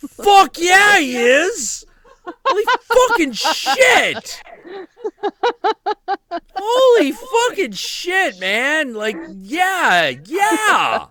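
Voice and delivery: high, loud, slow triumphant yelling; gleeful shout. Real, not acted. Reacting to the Talia al Ghul joke, gleeful loud shouting. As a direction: crow it out in delight.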